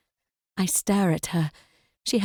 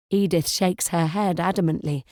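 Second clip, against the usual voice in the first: plain reading voice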